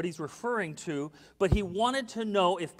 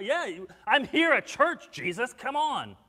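loud, raised-pitch insistence; mock protest; 5 semitones higher, 6 dB louder than usual. Churchgoer protesting to Jesus, emphatic and insistent. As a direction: protest as if it should be obvious.

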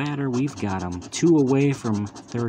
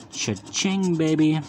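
high, bright win cry, cha-ching; excited cheer; 5 semitones higher, 3 dB louder than usual. Real, not acted. Scratching a lottery ticket and finding a winner, excited cheer. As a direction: celebrate the win out loud.

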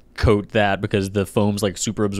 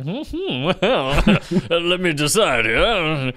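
high-pitched pondering, slow and hammed-up; mock deliberating judge; 7 semitones higher, much slower than usual. Impartial chili taster teasing his friends, mock deliberation. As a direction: ham up the weighing of a verdict, half laughing.

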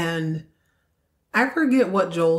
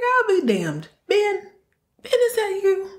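much higher, delighted surprise; surprised delight; 13 semitones higher than usual. Retold or imitated. Old army buddy recognizing Ben, high delighted surprise.

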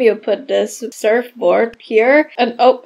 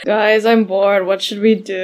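bored doll character; 4 semitones lower than usual. Pretend. Voicing bored boy doll.

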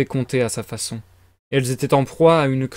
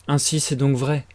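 ordinary delivery, nothing marked

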